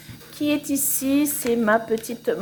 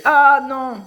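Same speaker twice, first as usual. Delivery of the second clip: loud burst of "oh no" realisation; sudden exclamation; 3 semitones higher, 9 dB louder than usual. Real, not acted. Crafter spotting something on the canvas, loud surprised exclamation. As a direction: break out louder, caught by surprise.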